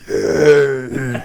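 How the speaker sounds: lightly voiced rattling skeleton cheer; a hint of a dry bony cheer. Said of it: "I'm imitating my Grave Guard".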